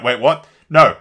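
loud, high-pitched retold bewilderment; mock alarmed confusion. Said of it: "my eyes sort of went".

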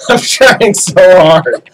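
dragged out through laughter; slow laughing. Real, not acted. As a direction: laugh it out slowly. Laughing at own failing attempts.

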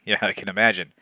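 ordinary talk

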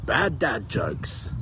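drawn-out title announcement; slow show title. Acted. Title announcement of each joke.

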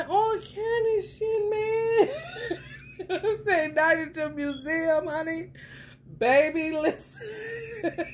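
high, crying with laughter; laughing hard. Real, not acted. Telling viewers about night at the museum, laughing high. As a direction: dissolve into laughter. Cracking up at herself.

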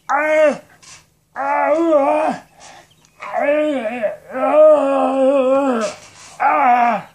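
very high, very loud cartoon screams; frantic full-voice shrieks; terrified screaming. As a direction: scream over and over in hammed-up horror. Character screams again at photo.

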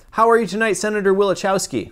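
lifted, upbeat TV host welcome; bright host greeting. Acted. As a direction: greet the guest with showbiz cheer.

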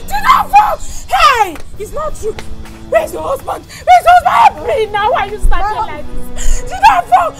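high, loud crying pleas; wailing plea. Acted. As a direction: beg him back to life through sobs. Begging dead husband to live.

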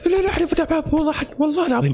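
shrieking high in panic that someone opened a door; real fright, voice shooting up; panicked. Real, not acted. Gamer hearing a door open, panicked high cries to viewers.

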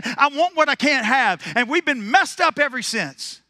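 high, loud, ringing preacher's cadence; preaching at full force. Acted. Preacher firing up the congregation, full-force preaching. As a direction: preach it out to the whole room.

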